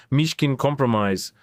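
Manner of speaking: ordinary talk, nothing marked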